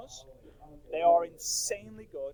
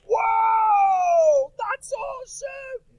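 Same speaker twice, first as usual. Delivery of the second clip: very high, loud, slow amazed shout; dad in the car, shouting with amazement, hammed up; imitating dad amazed; 11 dB louder, much slower than usual. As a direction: play the dad blown away by the tape. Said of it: "my dad being like".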